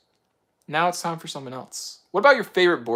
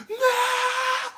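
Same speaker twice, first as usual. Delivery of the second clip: high, sudden shout of no; mock yell. Satan losing his grip, a high sudden yell. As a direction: yell it like a defeated devil. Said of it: "he yelled".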